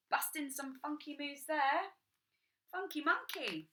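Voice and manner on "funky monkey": usual speaking voice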